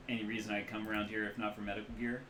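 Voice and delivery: ordinary talk, nothing marked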